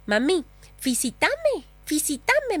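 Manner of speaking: lightly voiced little daughter asking for praise; toddler begging. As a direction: beg like a two-year-old. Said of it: "me decía".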